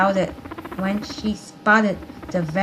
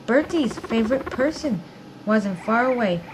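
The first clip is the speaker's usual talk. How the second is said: ordinary delivery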